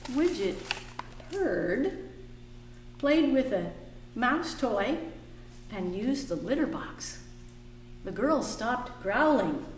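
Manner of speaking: lively picture book reading; reading to children. A narrator's reading. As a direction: read it warmly to the kids.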